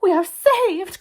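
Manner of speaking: high, loud exclamation; joyful cry. A narrator's reading. Missus Watson cries out as the firemen arrive, exultant. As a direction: cry out at being rescued. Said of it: "cried Missus Watson".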